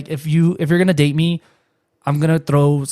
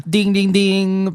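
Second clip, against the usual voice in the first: high, drawn-out ding, ding, ding; mock attention chime; 5 semitones higher, much slower than usual. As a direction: chime it slowly like a PA bell.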